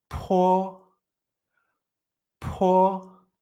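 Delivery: "Po" with a burst of air before the vowel; aspirated p. Shown as a demonstration. Teacher showing the explosión de aire, strongly aspirated demonstration. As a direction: puff hard on the p of "Po", then hold it level. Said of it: "pero sacando aire".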